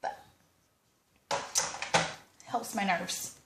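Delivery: talking while chewing gum. Real, not acted. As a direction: chew gum as you speak. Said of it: "disrespectful by chewing gum".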